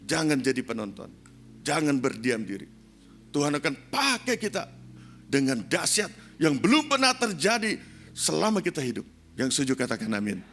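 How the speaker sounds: loud preaching with surging pitch; rally the congregation with punched, forceful phrases; rousing exhortation. Real, not acted. Preacher calling the church into God's movement, rousing shout.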